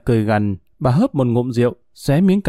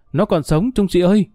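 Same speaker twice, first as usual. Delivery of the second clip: higher-pitched call up to the sergeant; excited cry; 5 semitones higher than usual. Acted. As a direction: call out the discovery with mild excitement. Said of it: "gã reo lên". (reo lên mừng rỡ, giọng cao)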